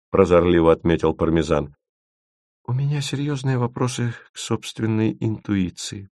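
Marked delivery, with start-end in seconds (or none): 2.6-6.1 soft, high, rueful self-mockery. A narrator's reading. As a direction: own up softly, with a bitter half-smile. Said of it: "с горестной усмешкой сказала Маша". (с горестной усмешкой)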